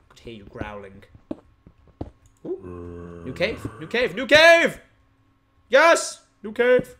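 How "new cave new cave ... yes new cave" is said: building to a high, loud cheer; excited shouting. Real, not acted. Gamer discovering a new cave, excited shouting. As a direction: celebrate louder and higher with each repeat.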